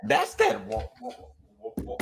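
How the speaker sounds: high squeaky falsetto whoa. Pretend. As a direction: jump way up into falsetto. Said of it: "Do it again".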